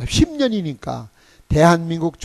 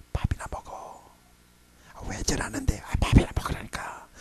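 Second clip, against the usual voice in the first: hushed back-and-forth, under the breath; whispered exchange; 5 semitones lower, 9 dB quieter than usual. Retold or imitated. Pastor and preacher whispering during the meal. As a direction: keep it secret, barely voiced.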